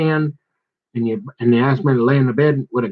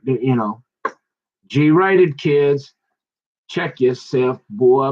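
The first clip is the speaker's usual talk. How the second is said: normal talking voice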